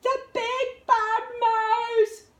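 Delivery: high, slow, terrified yell. A narrator's reading. Gruffalo's child fleeing the shadow, a slow terrified yell. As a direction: scream it and run. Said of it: "yelled the Gruffalo's child".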